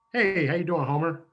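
normal talking voice

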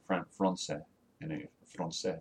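"Français" said the French way, nasal "an"; "Français" repeated with a French nasal vowel; French pronunciation. Shown as a demonstration. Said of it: "French for French".

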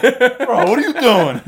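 high, loud protest near laughter; mock outraged protest. Imitating prankster reacting to the squeal, mock outrage.

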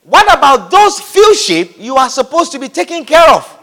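mocking elder brother voice; scornful brother. Eliab mocking David's flock, scornful put-on voice. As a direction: sneer about the sheep. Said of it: "he demanded".

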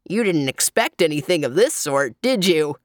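lightly voiced, teasing clown; leering clown. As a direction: lean in and leer like a clown. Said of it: "leering at her in clown fashion".

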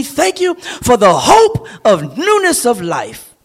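high-pitched, building pulpit climax; fervent preaching. Real, not acted. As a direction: build each phrase into an impassioned thank-you, dropping on the refrains. Sermon climax of thanksgiving.

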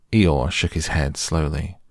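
ordinary delivery, nothing marked

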